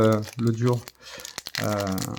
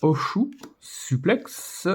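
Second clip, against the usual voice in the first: slow English card names in a French accent; 2 semitones higher, much slower than usual. Real, not acted. Apologetic collector reading English card names to viewers, French-accented English. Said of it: "vous m'excuserez pour mon accent anglais".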